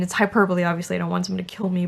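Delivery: usual speaking voice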